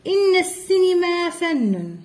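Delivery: high, slow إن السينما run together as inna-ssinima; linking hamza. Shown as a demonstration. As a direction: glide from إن into the s of السينما. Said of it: "ما نطقناش كذلك السينما".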